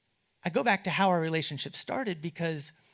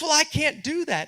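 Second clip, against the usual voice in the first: high, louder indignant refusal; higher, louder stubborn retort; defiant reply; 12 semitones higher, 6 dB louder than usual. Retold or imitated.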